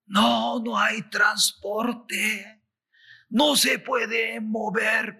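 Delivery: high, drawn-out mock complaint; people protesting. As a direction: wail it slowly, as a crowd with no way to travel. Voices people complaining about no transport.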